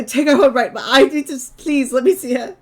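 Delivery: high, loud tearful begging; crying plea. Acted. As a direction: plead through sobs to see her. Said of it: "it just sounds like he's crying".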